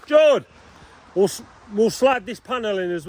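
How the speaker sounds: shouting a name across the yard; calling out. Real, not acted. Calling out to George across site.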